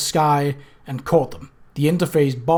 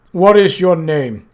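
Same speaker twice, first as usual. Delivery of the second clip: slow, louder menacing voice of Death; Death, cold and menacing; 3 semitones higher, 8 dB louder, slower than usual. Acted. Death confronts the intruders, slow and menacing. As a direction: loom over them with every word.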